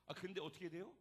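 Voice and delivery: usual speaking voice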